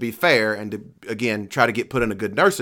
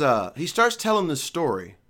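normal talking voice

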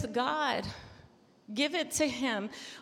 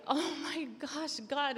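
overwhelmed prayer; 4 semitones higher, 4 dB quieter, slower than usual. Pretend. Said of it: "you get on your knees and you're like".

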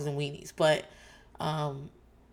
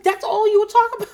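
much higher, louder disbelieving question; high, loud mock-incredulous retort; incredulous squeak; 11 dB louder than usual. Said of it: "I was like".

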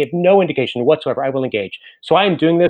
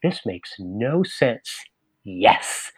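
slow, softer, charmed reading; delighted yes; 4 semitones lower, 4 dB quieter, much slower than usual. Retold or imitated. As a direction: relish the nonsense and accept it. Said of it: "she'll say".

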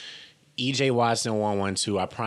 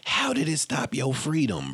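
usual speaking voice

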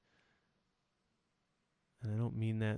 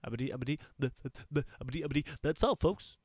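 high, loud stuttering cartoon pig sign-off; 5 semitones higher, 7 dB louder, much faster than usual. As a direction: stammer it out like a cartoon pig closing the show.

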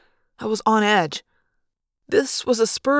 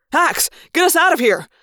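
high, raised call to the pilot; urgent order; 7 semitones higher, 4 dB louder than usual. A narrator's reading.

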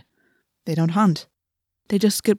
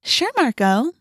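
high, syrupy agreement; mock sweetness; 8 semitones higher, slower than usual. A narrator's reading. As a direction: humour him with fake innocence. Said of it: "She batted her eyes".

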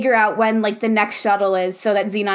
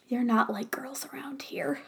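whispering; 12 dB quieter than usual. Retold or imitated. Quoting cheesy line, whispered.

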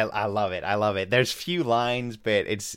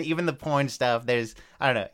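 ordinary delivery

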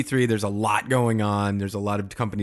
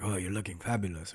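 put-on soft, half-hearted German accent; lightly imitated, unconvincing German accent; botched German accent; 7 dB quieter than usual. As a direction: attempt a German accent and miss. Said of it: "That's not a German accent".

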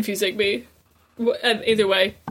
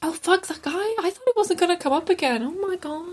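exasperated; 8 semitones higher than usual. Real, not acted. Reacting to villain's return.

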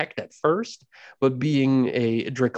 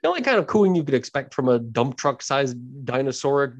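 ordinary talk, nothing marked

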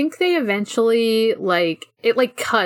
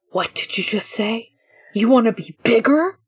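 menacing villain, slowing down at the end; cartoon villain. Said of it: "such a cartoon villain".

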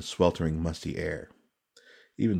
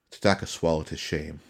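ordinary delivery, nothing marked

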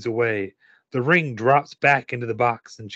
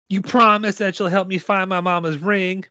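higher-pitched little girl lightly voiced; a hint of a child's voice, pitched up; lightly voiced Mary; 6 semitones higher than usual. A narrator's reading. Girl holding a helper to a promise, lightly voiced child. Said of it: "Mary says".

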